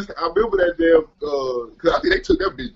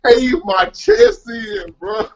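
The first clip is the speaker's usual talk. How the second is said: laughing; 12 semitones higher, much slower than usual. Real, not acted. Laughing while telling crash.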